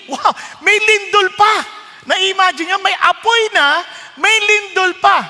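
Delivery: high, loud amazed exclaiming; excited. Real, not acted. Preacher marvelling to the church about fire and earthquake, excited. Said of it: "excited reaction to the verse".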